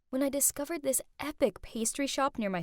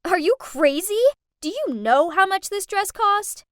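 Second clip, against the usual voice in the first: loud, higher furious yell; screaming; 6 semitones higher, 9 dB louder than usual. Acted. Jasmine screaming at the maid over her dress, furious. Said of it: "She pushed my mom and screamed".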